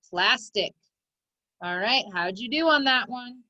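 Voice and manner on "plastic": "plastic" blended quickly into one joined word; said fast. Shown as a demonstration. Teacher showing pupils the whole word, said fast. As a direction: run both syllables of "plastic" together without a gap. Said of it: "Say it fast".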